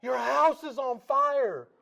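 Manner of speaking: high, loud frantic yell; screaming a name. Retold or imitated. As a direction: yell his name in panic. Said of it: "She starts screaming".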